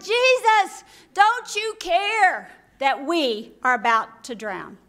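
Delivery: high, loud, slow shout over the storm; desperate shout. Retold or imitated. Disciples over the raging storm, desperate loud shout. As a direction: yell to be heard above the wind. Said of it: "and they shouted".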